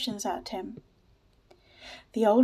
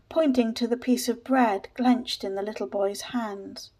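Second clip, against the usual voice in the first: plain reading voice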